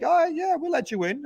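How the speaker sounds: high, sing-song mock agreement. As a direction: toss it off in a high voice. Voicing Russians agreeing.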